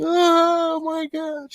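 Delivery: high, slow wailing imitation; mock wailing woman. She's bawling, he imitates.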